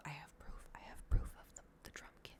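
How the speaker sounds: conspiratorial whisper. Real, not acted. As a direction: drop into a secretive whisper. Secretly talking of proof against neighbour.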